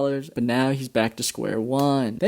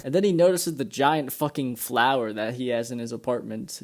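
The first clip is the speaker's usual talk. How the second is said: normal talking voice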